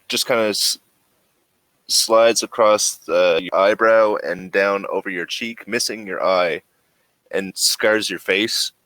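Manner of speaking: ordinary talk, nothing marked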